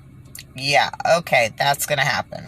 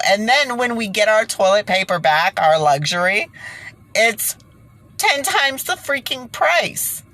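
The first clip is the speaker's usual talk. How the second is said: higher indignant rant at toilet paper hoarders; exasperated rant; 6 semitones higher than usual. Real, not acted. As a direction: tell the hoarders off, really fed up.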